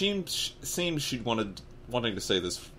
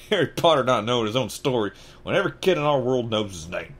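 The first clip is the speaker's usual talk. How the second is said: plain reading voice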